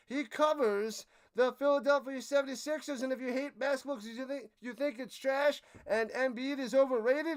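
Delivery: announcer-style shout-out, building to a big finish; hyped channel promo. Pretend. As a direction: sell his channel like a hype announcer. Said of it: "You love that intro?".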